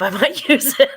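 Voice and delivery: high, loud words breaking into laughter; laughing. Real, not acted. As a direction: burst out laughing as you say it. Cracking up at own admission.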